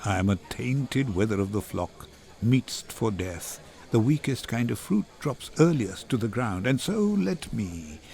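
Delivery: a hint of a doomed man, low and subdued; resigned Antonio. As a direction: speak low and resigned, as one marked for death.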